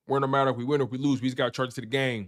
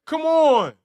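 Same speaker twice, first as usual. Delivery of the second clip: loud, high exasperated cry; exasperated outburst; 8 dB louder than usual. Real, not acted. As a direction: throw it out in disbelief.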